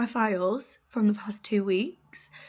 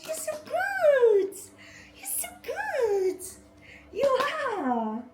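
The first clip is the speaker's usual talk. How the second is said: dog voice; slower than usual. Real, not acted. Owner cooing at her puppy in dog voice. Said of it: "talking a dog voice all week".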